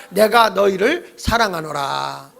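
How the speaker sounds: slow, drawn-out solemn voice of God; God's declaration. Shown as a demonstration. Preacher cueing the upper floor as God, slow and solemn. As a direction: stretch it out grandly as God. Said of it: "자, 시작".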